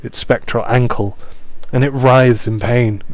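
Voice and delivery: normal talking voice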